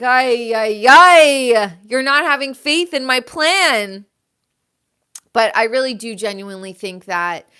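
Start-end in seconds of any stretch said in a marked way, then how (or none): 0.0-4.1 a hammed-up disappointed God; acting as God. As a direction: play God, exasperated with a sigh of ay ay ay. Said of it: "And God is like".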